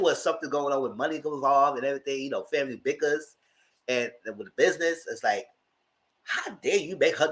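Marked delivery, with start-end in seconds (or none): none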